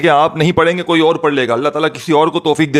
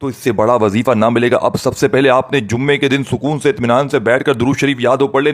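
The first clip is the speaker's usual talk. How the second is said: ordinary talk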